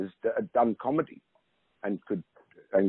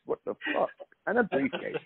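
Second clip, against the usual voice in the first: high, breathy mock disbelief; incredulous; 12 semitones higher, 5 dB quieter than usual. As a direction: gasp at the absurdity, half whispered.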